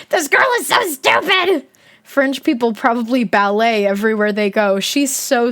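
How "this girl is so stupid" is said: high, laughing exclamation; real amused cry; laughing shout. Real, not acted. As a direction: blurt it out through the giggles. Laughing outburst at the book.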